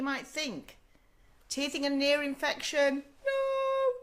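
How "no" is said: playful no. Real, not acted. Answering her own question.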